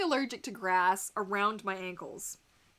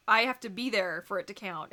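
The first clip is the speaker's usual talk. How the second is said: normal talking voice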